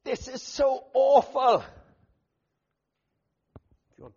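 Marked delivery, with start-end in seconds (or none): 0.0-1.6 loud, high, slow outcry of pain; preaching outburst of anguish; anguished cry. Pretend. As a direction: cry out at the suffering in front of you. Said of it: "Stand long enough to think".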